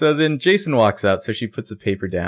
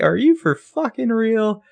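high, stunned disbelief, slowed; mock Emily gaping at her friend, pitched way up; incredulous; 13 semitones higher, 3 dB louder than usual. Emily's double take at Arya's shopping idea, high and incredulous.

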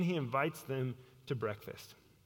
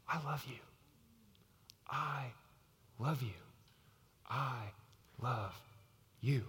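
hushed, near-whispered declaration; quiet, deeply sincere assurance; tender love; 4 semitones lower, 7 dB quieter than usual. Acted. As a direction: say it softly, a pause after each word. Jesus tells Peter he loves him.

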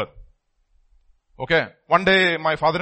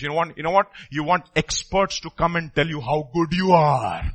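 normal talking voice

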